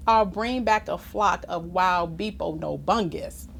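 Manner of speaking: ordinary delivery, nothing marked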